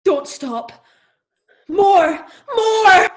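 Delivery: urgent plea rising to a high, loud cry; building ecstatic cries. Acted. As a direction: build from loud begging to a full shout. Acted sexual climax, building cries.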